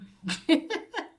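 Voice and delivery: high-pitched giggle; short burst of real laughter, rising high; amused laugh. Real, not acted. Narrator amused by Mark's hat remark, laughing.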